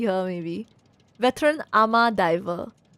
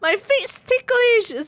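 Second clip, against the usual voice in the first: high character voice; 12 semitones higher, 4 dB louder, much slower than usual. Acted. Reading a character's line in a voice.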